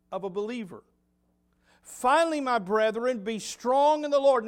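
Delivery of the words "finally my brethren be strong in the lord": loud, high proclamation of the verse; forceful scripture reading. Acted. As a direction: ring it out like a preacher's call.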